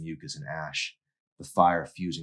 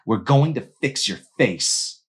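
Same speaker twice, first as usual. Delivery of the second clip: menacing threat; 8 semitones higher, 7 dB louder than usual. Acted. Mandy threatening Emery.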